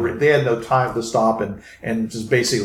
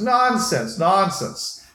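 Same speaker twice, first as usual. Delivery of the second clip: high, dismissive vizier scoff; oily scheming vizier; 7 semitones higher, much slower than usual. Acted. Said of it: "the vizier says".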